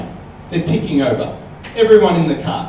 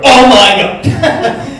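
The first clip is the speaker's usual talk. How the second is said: loud, slow, high fake panic scream; mock scream; 6 semitones higher, 9 dB louder, much slower than usual. Joking to the little girl on the ride, a hammed-up scream. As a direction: ham up a terrified shriek. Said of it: "as long as we don't go".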